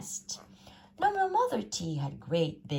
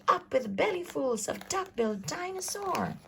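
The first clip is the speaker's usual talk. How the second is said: shouting; 11 semitones higher than usual. A narrator's reading. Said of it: "and they shouted".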